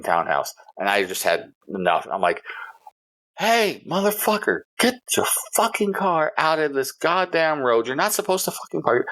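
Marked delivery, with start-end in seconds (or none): 3.4-9.1 livid yelling. Retold or imitated. Fed-up resident cursing at a neighbour over a parked car, livid yelling. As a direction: re-enact cussing out a neighbour from the street. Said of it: "I'm yelling this thing".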